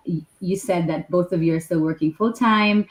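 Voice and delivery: usual speaking voice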